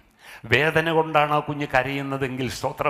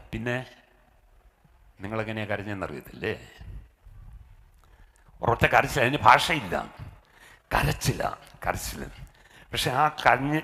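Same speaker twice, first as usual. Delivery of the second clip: ordinary delivery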